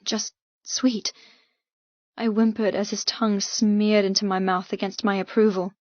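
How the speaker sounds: ordinary delivery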